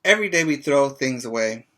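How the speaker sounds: normal talking voice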